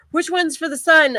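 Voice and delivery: lightly acted, high-pitched question from a brother; imitating brother.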